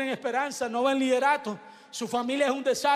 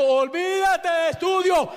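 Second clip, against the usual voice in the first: imitated harsh shout, slow and rising; merciless doctor; 5 semitones higher, 5 dB louder, slower than usual. Merciless doctor dismisses student's protest, imitated shouting. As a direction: shout it down at the patient, drawn out. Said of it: "sin misericordia".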